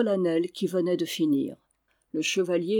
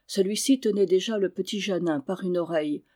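usual speaking voice

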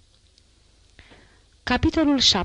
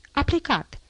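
mild call in a higher voice; daughter's announcement, pitched up without shouting; 8 semitones higher than usual. A narrator's reading. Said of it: "strigă o voce".

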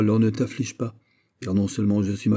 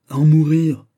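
anguished cry; 7 semitones higher, 9 dB louder than usual. Acted. Guiche confesses his jealousy.

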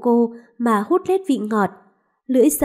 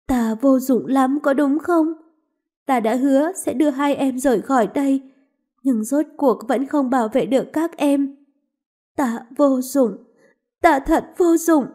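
anguished self-reproach; 2 semitones higher than usual. Acted. Mistress failing to protect her maids, anguished. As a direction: blame yourself, close to tears. Mistress blames herself, crying.

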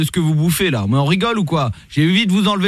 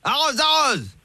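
mock command; 9 semitones higher, 4 dB quieter, slower than usual. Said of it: "commissaire Latour".

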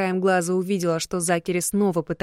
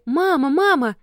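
put-on little girl yelling for mom, higher; shouting; 8 semitones higher, 5 dB louder than usual. A narrator's reading. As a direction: cry out for mom in a panic. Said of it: "с криками".

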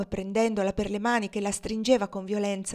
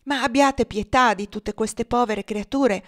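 higher, louder appeal for pity; urgent plea; 4 semitones higher, 8 dB louder than usual. A narrator's reading. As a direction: plead forcefully on behalf of others. Woman urgently pleads with Tom. (supplica accorata)

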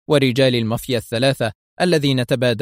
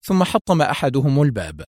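ordinary delivery, nothing marked

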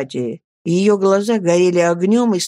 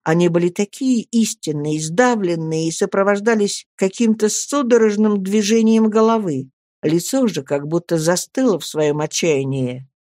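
ordinary delivery

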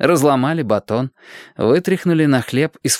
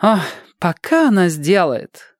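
coquettish groan; 8 semitones higher than usual. A narrator's reading. Tina moans coyly that grandma is slow, higher and drawn out. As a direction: sigh it out, playing coy. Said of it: "со стоном пококетничала Тина".

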